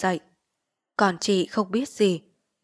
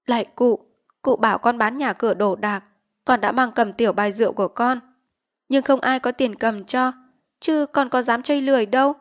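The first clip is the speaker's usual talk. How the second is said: a hint of a humble plea to the chief; lightly voiced pleading villager; 4 semitones higher, 6 dB louder than usual. Debtor pleading with the village chief, humble plea.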